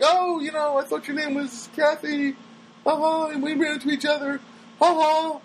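high, sing-out mimicry of a woman; imitated lady. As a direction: mimic her small talk way up high. Acts out the lady's chuckling small talk.

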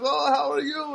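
put-on high girlish small talk; mimicked Armenian girl. Store girl greeting him, high mimicked voice. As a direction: ask it in her high imitated voice. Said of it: "she talks like this".

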